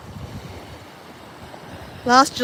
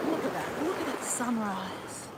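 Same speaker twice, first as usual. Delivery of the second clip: soft, slowed-down murmur of wonder; quietly marvelling at the sunrise; hushed awe; 15 dB quieter than usual. Real, not acted. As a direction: hold your breath at the view, barely voiced.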